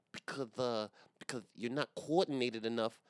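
high lispy Tyson voice; Mike Tyson impression. Still mocking his own stumble as Mike Tyson, high lisp. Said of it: "Mike Tyson".

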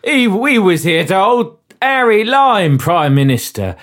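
broad, hammy Cockney sidekick; Cockney accent. Acted. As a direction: protest in a badly faked Cockney. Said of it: "as nuanced as this Cockney accent".

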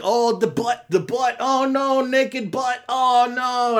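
high-pitched, drawn-out mock dismay; mock horror. Reviewer mocking a film's naked-fight gag, mock horror. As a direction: wail in pretend alarm.

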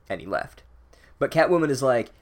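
ordinary talk

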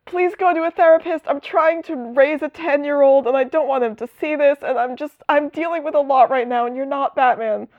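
very high, begging and worn-out voice; high exasperated Dick. Retold or imitated. Dick pleading with Jason to stop, very high exasperated whine. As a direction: beg him in a high tired whine. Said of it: "Dick's like".

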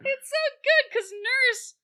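high, giggly squeal; laughing delight. Real, not acted. Explaining the nurse-name joke, laughing delight. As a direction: squeal it through amusement.

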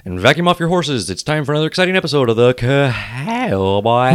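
big slow announcer intro; booming kids show opener. Acted. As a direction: boom it out like a kids' TV host.

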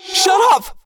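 high, loud shout; piercing outburst to silence someone; screamed command. A narrator's reading. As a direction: scream it with full force. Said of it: "I screamed".

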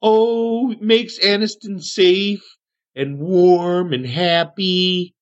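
high, sing-song voice slowing at the end; Aniston, childlike contentment. Acted. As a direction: savour it in a high, drawn-out hermit voice. GM as Aniston, proud of ring.

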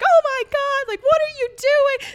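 very high, very loud panicked cry; retold screaming. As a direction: re-enact screaming at the officers in disbelief. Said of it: "like, screaming".

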